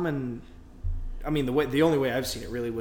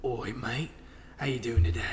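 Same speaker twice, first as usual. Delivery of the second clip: put-on rough go at a British regional accent; casual British "Oi, mate" greeting, softly attempted; Billy Butcher; 3 dB quieter than usual. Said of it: "it's the accent that Billy Butcher does".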